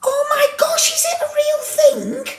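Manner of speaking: very high squeaky disbelief; real surprise, pitched right up; astonished. Real, not acted. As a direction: squeal it as the discovery lands. Learns the odd word is real.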